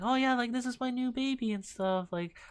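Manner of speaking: high, mock old lady gushing about her puppy. Imitating the old lady showing off her new puppy, high and doting. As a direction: coo it like a proud new pet owner. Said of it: "she was, like, saying".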